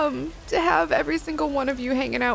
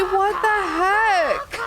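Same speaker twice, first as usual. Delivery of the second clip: drawn-out, higher What the heck of real surprise; stunned disbelief; 7 semitones higher, much slower than usual. Real, not acted. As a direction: slowly blurt out your shock at the gift. Stunned by a big gift.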